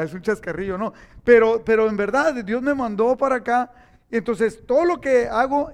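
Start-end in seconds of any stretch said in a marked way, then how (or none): 1.2-5.7 louder, driving the point home; preaching emphasis. Real, not acted. Preacher to congregation, loud emphatic insistence. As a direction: push it out to the congregation. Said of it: "preaching emphasis".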